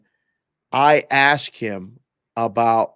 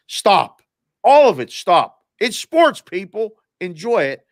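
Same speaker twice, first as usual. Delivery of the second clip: louder, higher, fed-up call to stop; emphatic rant; 5 semitones higher, 4 dB louder than usual. Real, not acted. Host telling golf purists to stop, louder fed-up rant. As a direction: shut the complainers down hard.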